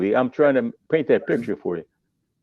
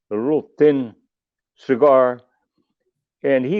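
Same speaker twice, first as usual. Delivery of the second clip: ordinary talk, nothing marked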